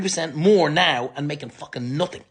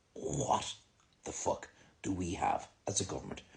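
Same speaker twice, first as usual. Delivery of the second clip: softer, breathless mutter of disbelief; whispered disbelief; 9 semitones lower, 13 dB quieter than usual. Retold or imitated. Citizens watching the Dáil, murmuring stunned disbelief. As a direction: mouth it in stunned quiet. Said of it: "we sit here and look and go".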